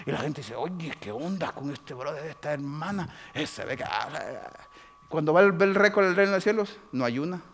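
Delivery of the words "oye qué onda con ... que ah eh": puzzled gossip. Retold or imitated. Onlookers murmuring about the brother, bemused. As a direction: murmur doubtfully.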